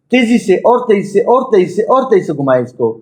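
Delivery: insistent, rolling push to speed up; urgent urging. Real, not acted. Urging meditators to spin faster.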